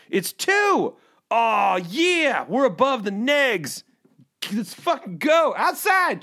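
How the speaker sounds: hammed-up joy at two degrees; mock celebration. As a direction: cheer the forecast like a game win. Celebrating weather above zero.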